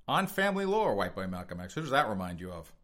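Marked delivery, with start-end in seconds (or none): 0.1-0.9 pitched-up sarcastic echo; mocking repeat. Real, not acted. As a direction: scoff the phrase high and loud. Mocking the quoted excuse.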